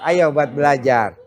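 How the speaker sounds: ordinary talk, nothing marked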